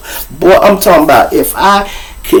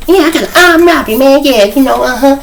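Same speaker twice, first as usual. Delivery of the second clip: high, squeaky, hurried mumble; funny squeaky voice; 10 semitones higher than usual. Retold or imitated. Imitating the big inmate confirming his charge, squeaky voice. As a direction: rattle it off in a silly squeak. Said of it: "he had a funny voice".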